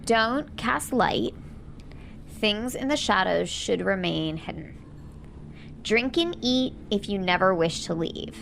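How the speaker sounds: usual speaking voice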